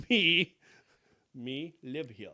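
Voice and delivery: a hint of a caveman grunting; clumsy broken caveman speech. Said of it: "turn into a caveman".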